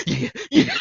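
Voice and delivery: laughing yeah. Retold or imitated. Brother's reply acted out.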